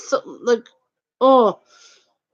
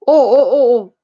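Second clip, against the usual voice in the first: sudden realization; 2 semitones higher, 6 dB louder than usual. Retold or imitated. Realizing the insult.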